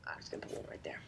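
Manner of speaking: unvoiced sneaky whisper; conspiratorial whisper. Real, not acted. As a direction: whisper it like a secret plan. Sneaky placement in game.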